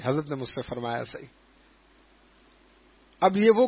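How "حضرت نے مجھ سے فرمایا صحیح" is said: choked up. Real, not acted. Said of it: "choked with emotion".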